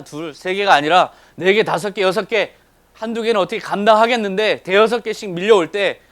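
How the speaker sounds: loud, rising count of troubles; urgent, high-energy preaching build; mounting overwhelm. Real, not acted. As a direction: pile them up with growing intensity.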